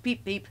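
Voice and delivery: high chirpy whistle call; whistle peep. A narrator's reading. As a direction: pipe it like an engine whistle. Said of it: "Thomas whistled".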